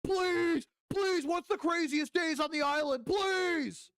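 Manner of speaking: high whiny pleading, climbing to a shout; begging Elon. Retold or imitated. Mocking Elon begging Epstein, high whiny plea. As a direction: beg like a desperate fanboy. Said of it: "Elon was begging".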